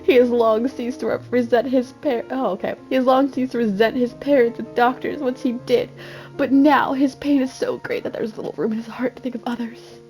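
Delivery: ordinary talk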